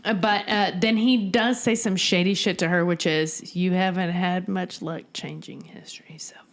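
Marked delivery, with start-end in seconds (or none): 3.5-6.4 whispered. Retold or imitated. Quoting shady line.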